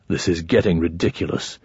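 low, soft grumble; grumbled. A narrator's reading. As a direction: grumble it under your breath. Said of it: "Tokar grumbled".

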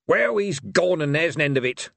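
short and gruff, cutting it off; curt. A narrator's reading. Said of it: "said Truckle the uncivil shortly".

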